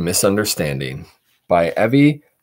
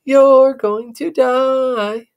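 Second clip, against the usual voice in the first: slow, louder teasing chant; louder sing-song taunt; 7 dB louder, much slower than usual. Acted. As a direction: lean into the taunt harder. Sister taunts again.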